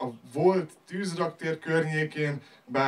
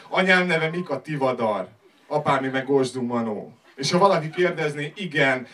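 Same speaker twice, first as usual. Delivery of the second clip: usual speaking voice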